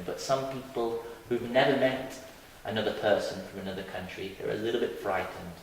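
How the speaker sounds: ordinary delivery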